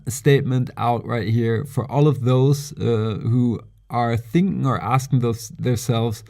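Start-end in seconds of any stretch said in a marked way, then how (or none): none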